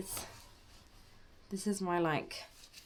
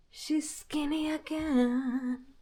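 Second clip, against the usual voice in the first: high squeal; 10 semitones higher, much slower than usual. Real, not acted. Showing flat belly, pleased.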